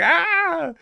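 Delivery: high, loud excited ah; delighted yelp. Retold or imitated. Friend losing it over the Boston accent. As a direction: yelp with glee. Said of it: "And he's like".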